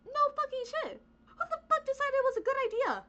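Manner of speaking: ordinary talk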